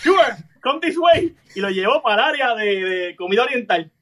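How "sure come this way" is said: store clerk voice. Retold or imitated. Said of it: "le dice".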